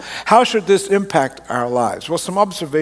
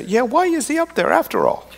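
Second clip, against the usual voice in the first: skeptical listener; 8 semitones higher than usual. Retold or imitated. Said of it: "You can say".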